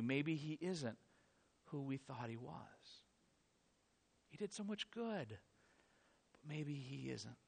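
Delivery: soft, slow, trailing-off doubt; a quiet, lost inner voice; hushed doubt. Acted. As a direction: let the doubt barely escape your lips.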